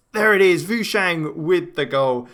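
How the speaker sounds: high, loud goal shout easing down; excited goal call. Real, not acted. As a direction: burst out as the ball goes in. Goal scored during live commentary.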